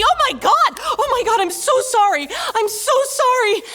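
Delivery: very high, loud frantic begging; panicked apology. Acted. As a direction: plead in acted terror for forgiveness. Child panics after cursing God.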